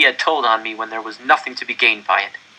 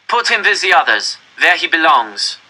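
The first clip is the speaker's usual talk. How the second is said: higher, louder order; officer giving a curt command; 6 semitones higher, 5 dB louder than usual. Acted. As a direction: bark the order to the capo.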